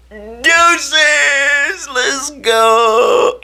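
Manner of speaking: very high, loud, stretched scream; hyped yell. Retold or imitated. Player running out after his introduction, screaming with hype. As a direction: yell it like charging onto the field. Runs out as name is announced.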